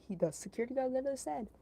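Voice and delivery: ordinary talk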